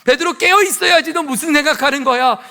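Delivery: high, loud, accusing scolding; pressing rebuke. Acted. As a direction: confront them by name, pressing hard. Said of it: "이렇게 다그쳐야죠".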